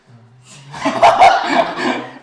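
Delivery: big burst of breathy laughter; loud laughter. Real, not acted. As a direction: crack up loudly at the joke. Class laughs at dialect. (크게 터지는 웃음)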